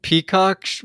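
ordinary talk

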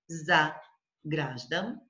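"за", a pause, then "граждан" as one piece; split after first syllable. Shown as a demonstration. Teacher shows first way to split, syllable break. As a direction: break the word right after "за". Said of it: "after the first syllable".

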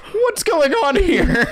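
high, slow, laughing mock disbelief; retold bewilderment. Storyteller replaying his confusion at the dog's nickname, high laughing bewilderment. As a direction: draw it out high through held-in laughter. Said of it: "I was just sitting there like".

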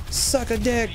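mock high nasty snap; mean retort. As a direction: fling it back like a rude troll. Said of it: "just being real mean".

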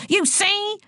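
high-pitched outburst at normal volume; indignant teacher cutting in; screamed outrage. A narrator's reading. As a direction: break in with a high, angry cry. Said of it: "screamed Boyle".

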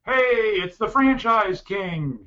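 high, loud call drawn out on the last words; imitated yell. Chamber director hailing Joel across a huge expo, imitated yell. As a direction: holler it as if across a big room. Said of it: "he yelled at the top of his lungs".

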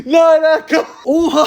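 high, loud stunned yell with a laugh; real excited outburst; shocked shout. Real, not acted. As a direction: cry out in disbelief. Still shocked by the prize.

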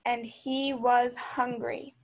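plain reading voice

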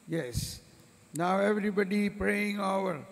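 normal talking voice

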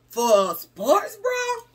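high, slow, incredulous; mock shocked. She imitates viewers shocked at the bra price, high and slow. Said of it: "some of y'all be like".

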